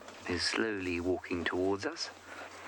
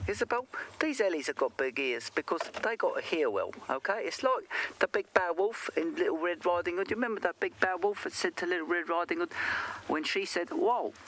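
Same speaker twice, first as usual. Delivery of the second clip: put-on higher East End London voice for a child; East End London accent; 9 semitones higher than usual. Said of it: "assuming it's from the East End of London".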